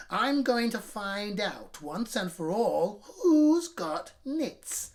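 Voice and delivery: slow, loud menacing growl; growling teacher. A narrator's reading. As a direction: growl the threat at the class. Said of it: "she growled".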